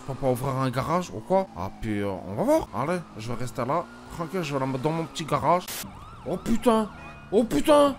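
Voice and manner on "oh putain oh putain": startled curse. Real, not acted. Startled by police.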